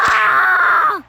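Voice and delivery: loud high shout of frustration; angry shout. Real, not acted. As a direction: shout out at the stupid bird. Gamer crashes, yelling at bird.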